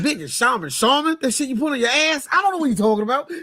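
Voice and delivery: high, quickening incredulous reaction; baffled rant climbing in pitch; baffled disbelief. Acted. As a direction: question it, then rattle off the dismissal. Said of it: "I'm like".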